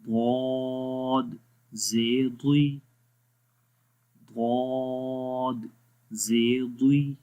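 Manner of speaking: letters drawn out, chanted one by one; slow recitation drill. Shown as a demonstration. Teacher reciting examples for Quran students, slow chant. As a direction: recite slowly for students to echo. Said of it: "اب اس کی مثالیں پڑھتے ہیں".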